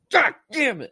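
high, loud mock outburst; retold anger. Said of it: "angry. I'm like".

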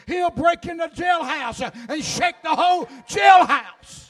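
loud, rising shouted declarations; hollering gospel preacher; full-force preaching. Acted.

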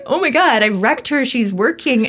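high, dramatised self-reproach; aghast at your own blunder; panicked dismay. Retold or imitated. Medium who upset a woman on the job, panicked dismay.

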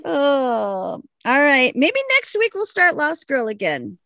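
ordinary talk, nothing marked

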